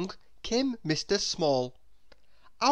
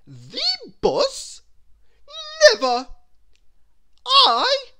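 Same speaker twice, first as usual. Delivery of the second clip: very high, loud disgusted refusal; a much higher, louder snobbish outburst; scornful Miss Splendid; 11 dB louder than usual. Acted. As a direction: recoil at the very idea of a bus.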